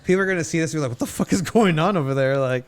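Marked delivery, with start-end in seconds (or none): 0.9-2.4 loud incredulous. Pretend. Imagined confused fan reaction.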